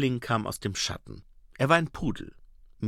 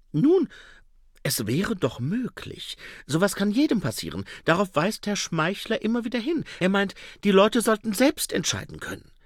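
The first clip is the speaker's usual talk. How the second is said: high, eager salesman voice; a slick huckster talking himself up; schmieriger Händler; 9 semitones higher than usual. A narrator's reading. Schnapper speaks, narrator voices. (hohe, eifrige Händlerstimme)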